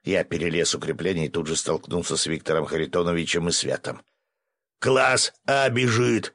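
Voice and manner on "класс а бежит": drawn-out, high warning call; alarm yell. A narrator's reading. Assistant warns camp of a coming monster, drawn-out yell. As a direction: slowly bellow the warning to the camp. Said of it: "заорал мой помощник".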